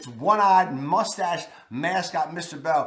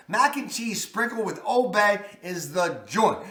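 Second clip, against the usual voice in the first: ordinary talk, nothing marked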